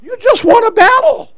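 put-on high, puzzled child's voice; little girl asking. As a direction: plead with Daddy in a high girlish voice. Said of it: "She said".

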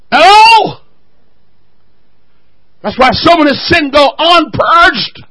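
high, loud preacher's challenge; full-force preaching. Acted. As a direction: fire it at the congregation.

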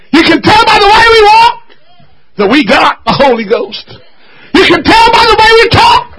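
high, loud whooping preacher cadence with a cry in the voice; preaching at full force. Acted. Preacher whooping to the congregation, high and loud. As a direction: lift the church with a shouted, sung-out refrain.

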